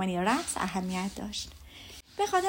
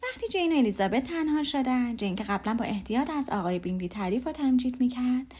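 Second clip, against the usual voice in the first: ordinary delivery, nothing marked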